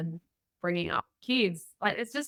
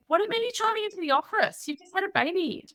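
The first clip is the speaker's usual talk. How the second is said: much higher, fast incredulous question; imitating a friend who can't believe it; shocked friend; 12 semitones higher, faster than usual. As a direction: friend on the phone, aghast at her driving to the office. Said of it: "And she said".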